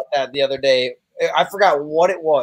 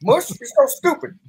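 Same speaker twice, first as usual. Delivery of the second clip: much higher, fast mocking voice; squeaky rushed put-on voice of a buddy; imitating Brandon Walker; 12 semitones higher than usual. Doing a friend's voice for the podcast crew, high and fast. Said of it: "Brandon Walker's like".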